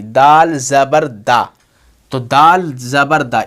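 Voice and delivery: slow, measured "दाल ज़बर दा"; same-sound demo. Shown as a demonstration. Teacher showing the sound stays unchanged, slow "दाल ज़बर दा". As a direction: stretch each word, ending on a steady "दा". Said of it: "आवाज़ वही निकालनी है".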